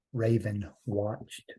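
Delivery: ordinary delivery, nothing marked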